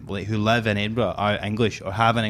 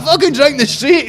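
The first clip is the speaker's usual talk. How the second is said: very high, loud mock Glasgow shout; Glaswegian mimic; 8 dB louder than usual. Mocking Glasgow drinkers with a high, loud Glaswegian squeal. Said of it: "They're like".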